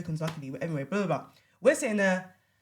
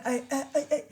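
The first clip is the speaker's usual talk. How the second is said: mock stammer, higher-pitched; stuttering; 7 semitones higher than usual. Woman mimicking her date lost for an answer, stuttering. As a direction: imitate someone stuck on the first word. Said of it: "He starts now stuttering".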